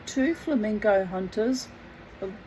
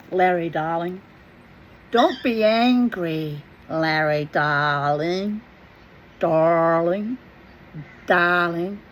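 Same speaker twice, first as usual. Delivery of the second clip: Yankee accent; 4 semitones lower than usual. Shown as a demonstration. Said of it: "Yankee Doodle accent".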